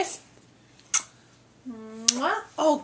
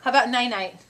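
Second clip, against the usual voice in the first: normal talking voice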